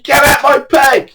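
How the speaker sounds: high, slow, loud command; furious high yell across the water; angry shout. Retold or imitated. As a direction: bark it at him. Quarrel with angler on bank.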